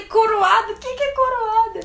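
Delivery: high, loud confused squeal; imitating her younger self, completely baffled; baffled disbelief. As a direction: squeak out the word in total confusion. Retelling her own confused reaction.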